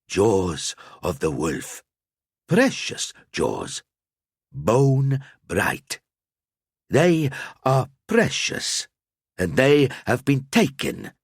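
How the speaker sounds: put-on slow, sing-song riddling old man; mystic seer. A narrator's reading. Al-Azir tells the knights of the stolen jaws, slow and sing-song. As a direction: savour each word like a secret.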